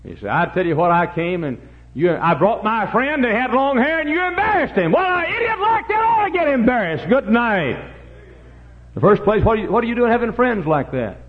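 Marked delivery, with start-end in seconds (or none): 0.3-4.9 loud protest rising in pitch; indignant complaint. Acted. Youth protesting his friend was embarrassed, indignant. 4.9-11.2 high, loud scolding; heated retort. Acted. Preacher scolding youth about his friends, heated shout.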